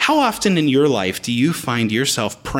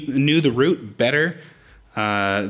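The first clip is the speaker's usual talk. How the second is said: usual speaking voice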